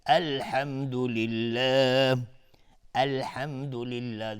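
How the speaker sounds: slow recitation, starting anew after the stop; tajweed recitation. Shown as a demonstration. Teacher showing the restart after stopping, slow recitation. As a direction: recite slowly from a fresh breath.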